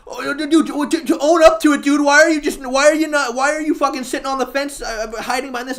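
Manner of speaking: high, loud mocking voice; hammed-up frustrated whine; whiny mock outrage. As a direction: parody his exasperated nagging. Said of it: "me saying that makes you go".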